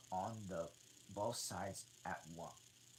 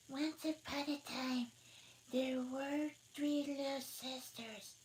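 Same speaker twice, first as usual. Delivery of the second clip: Dormouse in a hurry; 5 dB louder than usual. Acted. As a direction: tell it like a sleepy mouse. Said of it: "began in a great hurry".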